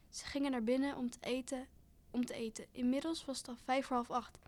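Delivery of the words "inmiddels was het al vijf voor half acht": ordinary delivery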